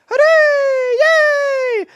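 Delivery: shrill falsetto cheer, very high and loud; squeaky video game character cheering hooray; shooting game guy cheer. Retold or imitated. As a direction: scream hooray like a cartoon cardboard cutout. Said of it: "I would just randomly go".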